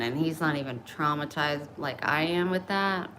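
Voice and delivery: normal talking voice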